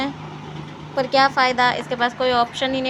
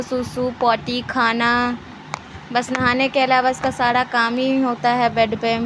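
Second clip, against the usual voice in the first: usual speaking voice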